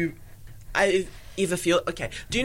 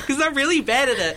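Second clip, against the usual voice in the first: higher-pitched, laughing confession; laughing admission; 8 semitones higher, 4 dB louder than usual. Real, not acted. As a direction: laugh at your own hopelessness as you say it. Laughing at own poor pool playing.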